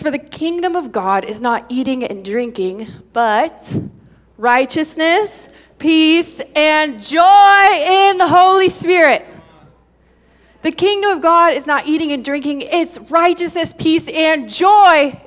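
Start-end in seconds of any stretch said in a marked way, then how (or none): 0.0-9.2 reading that swells louder on joy; scripture reading building. Acted.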